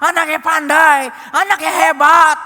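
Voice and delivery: usual speaking voice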